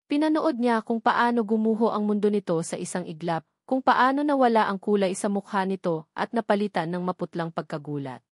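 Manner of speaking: ordinary delivery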